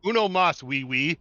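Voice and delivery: jokey put-on Mexican accent, loud on the repeat; mock Mexican accent. Gamer ordering another beer in a mock Mexican accent. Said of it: "when I do a Mexican accent".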